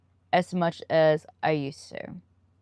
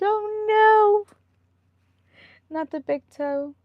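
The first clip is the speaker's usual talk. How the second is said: much higher, louder yelp of "Oh, no"; pained squeal; 3 dB louder, much slower than usual. Real, not acted. Speaker reacts to stubbing her big toe with a high yelp. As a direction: squeak in mock agony.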